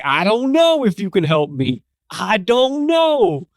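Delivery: weary, drawn-out big-guy voice, slow at the end; big Jersey dude, fed up after the long wait, lightly voiced; exasperated customer. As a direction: sigh out that he doubts they can help. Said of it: "He's like".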